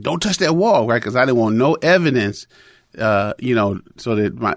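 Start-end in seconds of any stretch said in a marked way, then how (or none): 0.0-0.9 put-on raised, higher call to stop the child; sharp warning. Father calls to his blind son before the wall, raised warning. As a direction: snap out a warning to a toddler. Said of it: "I said".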